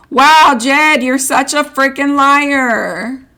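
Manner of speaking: loud, high, slow accusing shout; angry outburst. Real, not acted. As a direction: yell it at him in disbelief. Accusing Jed in the chat.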